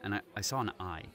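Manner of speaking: low, quiet, nervous customer; shaken customer. Acted. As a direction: report it nervously. Shaken customer reports eye.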